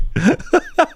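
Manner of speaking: high-pitched burst of giggles; real squealing laugh. Real, not acted. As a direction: crack up, laugh shooting upward. Laughing at his own joke.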